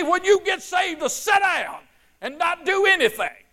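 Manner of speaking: high, loud, ringing sermon shout; full-force preaching. Acted. Preacher exhorting the congregation, shouting at full force.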